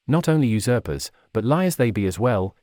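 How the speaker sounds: ordinary delivery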